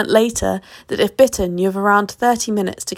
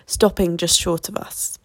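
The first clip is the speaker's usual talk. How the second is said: ordinary delivery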